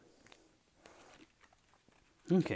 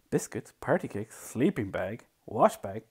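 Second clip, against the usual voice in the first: plain reading voice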